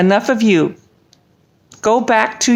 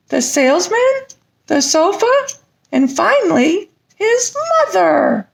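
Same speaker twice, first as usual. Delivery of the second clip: rhyming storybook read with a bounce; lively bedtime rhyme read aloud; story reading; 7 semitones higher than usual. Acted. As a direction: read the tale like a puppet to children. Puppet reads rhyming story aloud.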